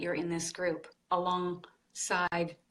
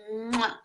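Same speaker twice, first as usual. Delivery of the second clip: blown kiss; 2 semitones higher than usual. Real, not acted. Host blows a kiss to viewer.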